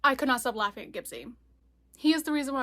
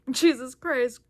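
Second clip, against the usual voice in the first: loud, mock roar of alarm; a reader's lightly voiced roar; 7 dB louder, slower than usual. A narrator's reading. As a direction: read it like a startled roar. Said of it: "Gibbsy roared".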